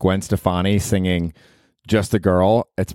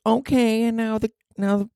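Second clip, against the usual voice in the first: high-pitched stage banter; 13 semitones higher than usual. Retold or imitated. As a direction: lead the crowd in a high singer voice. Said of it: "And then she goes".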